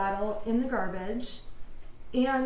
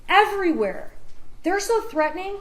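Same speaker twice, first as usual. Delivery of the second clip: passionate closing argument; 6 semitones higher, 7 dB louder than usual. Real, not acted. Closing argument, threats against her.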